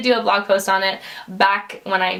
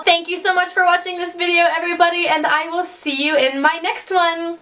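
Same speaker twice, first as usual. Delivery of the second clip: bubbly sign-off; 8 semitones higher than usual. Real, not acted. Cheerful vlog sign-off.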